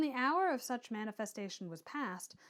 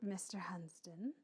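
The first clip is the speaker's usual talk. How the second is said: whisper; 4 semitones lower, 9 dB quieter than usual. Acted. Whispered name in dialogue.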